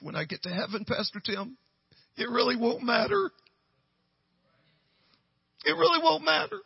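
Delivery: imitated emotional realisation, climbing high; retold tearful breakthrough. Michelle telling Pastor Tim through tears, imitated high emotional breakthrough. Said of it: "tears streaming down her face".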